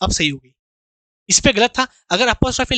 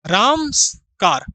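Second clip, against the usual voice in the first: slow dictation; much slower than usual. Shown as a demonstration. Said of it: "जैसे".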